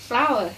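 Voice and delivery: flower with the weight on FLOW, the W silent; stress first syllable. Shown as a demonstration. Teacher modelling flower for students, stress on the first syllable. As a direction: lean on the front of flower. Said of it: "เน้นเสียงข้างหน้า".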